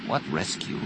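soft, hushed thought; inner thought. A narrator's reading. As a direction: think it to yourself, very softly. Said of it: "Jaina thought".

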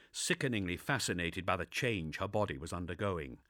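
plain reading voice

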